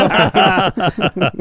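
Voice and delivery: a laugh pitched well up; high laugh. Real, not acted. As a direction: let out a high amused laugh. Laughs at dying joke. (høy latter)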